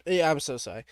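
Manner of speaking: normal talking voice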